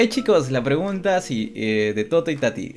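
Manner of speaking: ordinary talk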